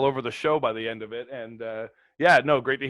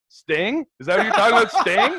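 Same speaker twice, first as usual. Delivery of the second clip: incredulous; 10 semitones higher, 7 dB louder than usual. Retold or imitated. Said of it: "They're like".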